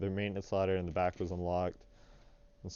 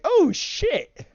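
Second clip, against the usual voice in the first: much higher, louder excited oh shit; super excited; 9 dB louder than usual. Retold or imitated. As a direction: burst out thrilled the door opened. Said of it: "I'm super excited".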